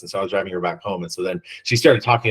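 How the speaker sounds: normal talking voice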